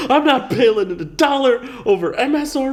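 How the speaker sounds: high, laughing fake defiance; laughing mock demand. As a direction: laugh through a bold customer ultimatum.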